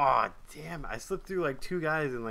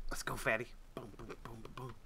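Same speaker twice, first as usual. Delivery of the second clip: whispering; 5 semitones lower, 7 dB quieter, faster than usual. Real, not acted. Urging game character on.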